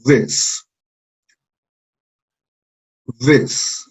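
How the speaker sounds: this said slow and clear for the class; model this. Shown as a demonstration. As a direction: say this for them to repeat. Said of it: "vamos a repetir".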